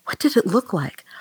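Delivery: eager, secretive whisper; curious whisper. Acted. Wife asks about the brothel.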